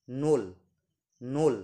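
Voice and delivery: নোল said as nol, clear and even; Russian nol demonstrated for learners; Russian word pronounced. Shown as a demonstration. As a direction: spell out নোল slowly like a teacher. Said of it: "উচ্চারণটা হচ্ছে নোল".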